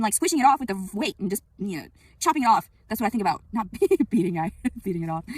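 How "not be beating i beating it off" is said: laughter breaking up the words; giggling. Real, not acted. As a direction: try to correct yourself while giggling. Laughs at own double meaning.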